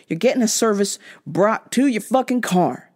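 ordinary talk

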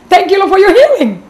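quoted jubilant cry, high and loud; happy, joyful. Retold or imitated. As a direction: exclaim thanks as if overjoyed. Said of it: "suddenly she's happy, joyful".

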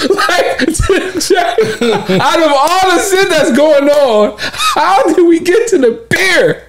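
high, helpless laughter through the words; cracking up. Real, not acted. Host retelling the beer story, laughing hard.

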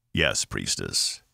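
bow to her authority; respectful low alien. A narrator's reading. Zal answers respectfully.

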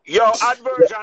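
loud hype call; hype shout. Real, not acted. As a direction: call it out like a toaster. Hyping up the crowd.

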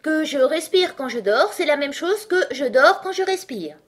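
high, loud sleep-talker's voice; sleep-talking Dormouse. A narrator's reading. Dormouse seemingly talks in its sleep, high and loud. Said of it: "qui semblait-il parler tout en dormant".

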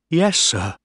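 put-on a nervous boy answering the captain; mildly frightened young voice; afraid boy. A narrator's reading.

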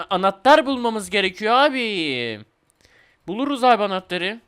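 normal talking voice

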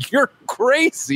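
high, slow comic accusation; laughing, exaggerated disbelief; mock alarm. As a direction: stretch it out in a high put-on voice. Mock verdict on Bruce's behaviour.

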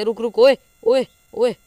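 loud, high calls to stop; urgent shout. Real, not acted. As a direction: yell repeatedly in alarm. (ज़ोर से चिल्लाकर रोकना)

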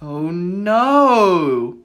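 ordinary talk

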